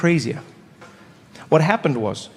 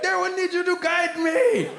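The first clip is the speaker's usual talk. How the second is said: mock-formal kid; 12 semitones higher, 4 dB quieter than usual. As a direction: announce it like a proud little kid, laughing. Kid announcing parental guidance.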